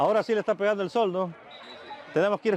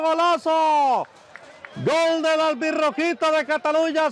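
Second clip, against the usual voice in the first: long drawn-out goal scream, much louder and higher; breakneck goal commentary; 9 semitones higher, 5 dB louder, slower than usual. Acted. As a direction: yell the goal with everything you have. Goal celebration call.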